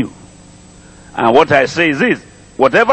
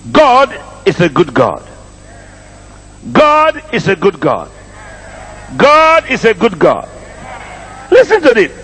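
emphatic refrain climbing into a raised-voice scripture reading; forceful preaching; 6 semitones higher than usual. Real, not acted. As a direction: preach it to the crowd, rising in pitch.

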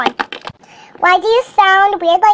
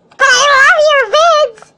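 high, slow, sugary mock voice of the commenter; mocking a fan comment in a cutesy voice; mock sweet; 8 semitones higher, 5 dB louder, slower than usual. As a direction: parrot the compliment in a syrupy, higher put-on voice. Mocking the commenter's own words.

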